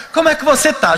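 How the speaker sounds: lightly voiced gentle Chico; kind old medium.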